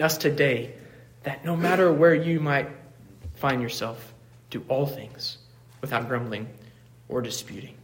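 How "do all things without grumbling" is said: ordinary talk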